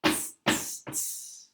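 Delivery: tsk back like cheeky monkeys. Acted. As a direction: monkeys mocking the peddler, whispered clicks.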